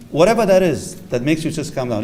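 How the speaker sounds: ordinary talk, nothing marked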